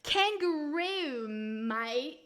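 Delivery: put-on loud, drawn-out Aussie accent falling away at the end; hammed-up Australian, slow and stretched; broad mock Australian accent. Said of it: "See, I know Australian".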